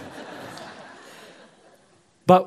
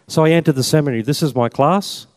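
normal talking voice

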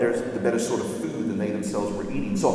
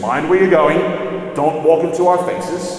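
loud, snappish dwarf, voice raised; surly dwarf; 5 semitones higher, 8 dB louder than usual. A narrator's reading. Dwarf scolding the children who bump into them, loud surly snap. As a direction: bark it at the children like a grumpy dwarf. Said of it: "in a surly voice".

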